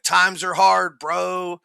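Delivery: high, loud whiny bro voice; loud mocking bro whine. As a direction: whine it like a spoiled rich kid. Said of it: "And he's like".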